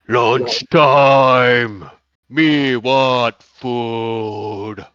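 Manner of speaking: loud, very slow giant bellow; booming giant voice. Acted. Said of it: "you hear a booming voice".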